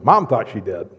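ordinary talk, nothing marked

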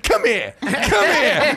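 mock angry. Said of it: "he's like".

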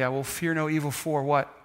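ordinary delivery

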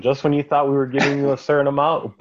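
ordinary talk, nothing marked

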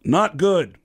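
stern, loud prank-call voice. Retold or imitated. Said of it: "if you called him as [name]".

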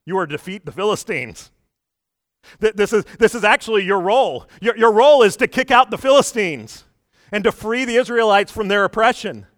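God pressing Samson, building to a call; God's voice. Retold or imitated. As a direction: voice of God rising to a loud, high urging. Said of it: "God's trying to teach him".

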